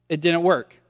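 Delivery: ordinary delivery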